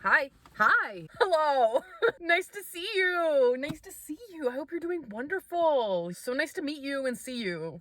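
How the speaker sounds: lilting high-pitched hello voice; sweet high greeting. Acted. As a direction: greet viewers in a sugary high voice. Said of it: "sweet greeting voice to viewers".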